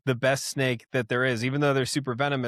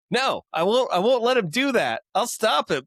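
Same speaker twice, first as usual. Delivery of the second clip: hero anime voice; 8 semitones higher than usual. Retold or imitated. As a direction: declare it like a brave anime hero. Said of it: "this very, like, hero anime voice".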